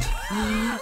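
sharp high intake of breath; gasp. Real, not acted. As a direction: gasp in reaction to the game. Game event startles the player.

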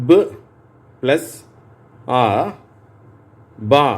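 each sound apart with long pauses, then the joined syllable; phonics blending drill. Shown as a demonstration. Teacher to pupils, repeat after me: a slow, spaced phonics drill. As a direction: say பு, then plus, then ஆ, then blend them into பா. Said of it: "நான் சொல்ல சொல்ல கவனிங்க, நீங்களும் திருப்பி சொல்லுங்க".

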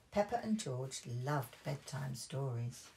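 plain reading voice

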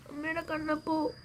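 high, soft cooing to the little one; high cooing call. Acted. Mother coos to her baby, high and soft. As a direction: coo the name up high and softly.

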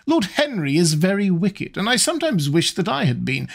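mother him with mild bossiness; a hint of a matchmaking old lady. Lady Narborough lectures Dorian.

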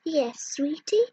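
ordinary talk, nothing marked

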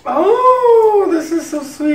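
high, loud delighted cry. Real, not acted. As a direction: gush over a sweet gift. Touched by the gift.

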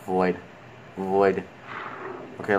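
normal talking voice

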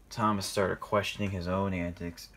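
plain reading voice

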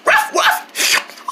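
high, breathy pretend bye-bye bark; playful dog bark. Dog barking bye to the viewers, high pretend barks. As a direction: yap goodbye like a puppy.

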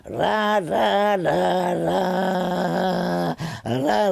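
mock trumpet growl, sung out; growled trumpet riff. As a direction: hum the growling trumpet part. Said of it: "Leo liked the way I growled".

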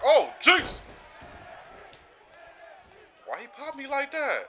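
high, loud shocked yelp; startled outcry. Real, not acted. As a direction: cry out at the sudden hit, high. Hit by a weapon.

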